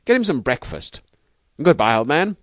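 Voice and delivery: high, loud, delighted outpouring; overjoyed. A narrator's reading. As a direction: gush thanks, then rush to get away. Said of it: "stammering in his delight".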